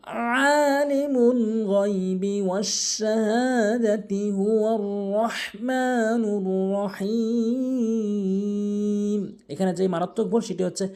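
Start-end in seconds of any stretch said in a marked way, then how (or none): 0.1-4.3 slow, drawn-out melodic chanting; Quran recitation. Shown as a demonstration. 4.3-9.4 slow, drawn-out melodic chanting; Quran recitation. Shown as a demonstration.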